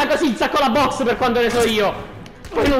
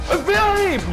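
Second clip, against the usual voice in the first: mock police shout; 9 semitones higher, slower than usual. Announcing police at the door.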